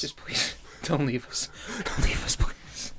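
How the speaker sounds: unvoiced begging whisper; whispered plea. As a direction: whisper a desperate plea to viewers. Mock begging viewers.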